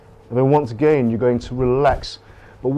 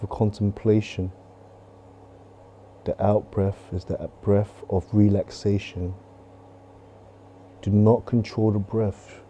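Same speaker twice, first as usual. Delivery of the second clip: calm, even meditation guide voice; steady instructor guiding a meditation; guided meditation; 3 semitones lower than usual. Acted. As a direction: lead the breath work gently. Leads a body meditation.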